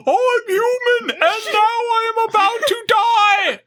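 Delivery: very high, wailing death cry; hammed-up yell. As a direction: overact a doomed villain's last words. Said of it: "Why does he yell".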